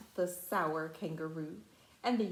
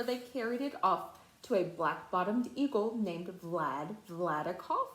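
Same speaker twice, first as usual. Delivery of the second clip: plain reading voice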